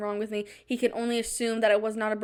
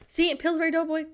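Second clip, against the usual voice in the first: high, squeaky giggle; Doughboy giggle; 6 semitones higher than usual. Shown as a demonstration. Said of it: "I can do an example".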